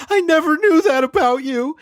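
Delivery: high, loud mock-tearful cry; hammed-up wail of a wife's astonishment; emotional wife. Imitating the wife answering her crying husband, mock-emotional. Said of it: "assuming his wife is like".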